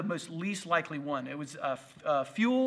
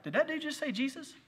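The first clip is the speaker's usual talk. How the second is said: high, laughing mock bar patron; puzzled bar guys; 9 semitones higher than usual. Pastor imitating confused bar patrons, high and amused. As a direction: play the confused drinker, half laughing. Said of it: "the guys would realize, they'd be like".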